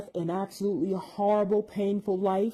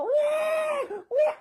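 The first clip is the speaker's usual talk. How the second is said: high, held mock newborn wail; two high-pitched fake baby cries; imitated baby wail; 3 dB louder than usual. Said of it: "that screaming and crying doesn't stop".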